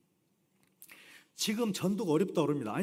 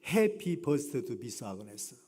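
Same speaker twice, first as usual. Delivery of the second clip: drawn out; 6 semitones lower, much slower than usual. Retold or imitated. Reading the banner aloud, drawn out.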